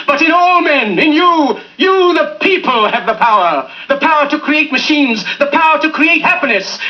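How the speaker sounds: building to a soaring shout; impassioned speech. Acted. Speech builds to rousing climax.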